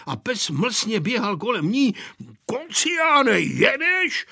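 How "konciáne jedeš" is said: high-pitched grumpy call; grumbling. A narrator's reading. Grandpa scolding the dog that circles him, grumbling. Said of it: "bručel dědeček".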